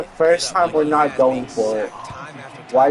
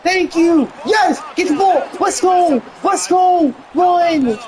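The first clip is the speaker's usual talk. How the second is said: excited shouting; 10 semitones higher, slower than usual. Real, not acted. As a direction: yell for the big play. Cheering a big play live.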